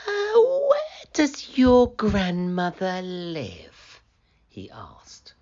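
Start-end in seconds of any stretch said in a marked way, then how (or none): 0.1-4.0 slow, higher, sweet-talking wolf; wolf's softest voice. Acted. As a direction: coax her gently like a trickster. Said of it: "He put on his softest voice".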